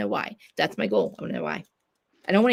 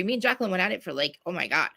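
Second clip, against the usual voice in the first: ordinary talk, nothing marked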